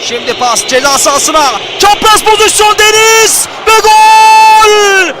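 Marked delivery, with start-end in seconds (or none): 3.7-5.1 goal call, loud and high; screaming football commentator. Real, not acted. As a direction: roar the goal. Goal called in live commentary.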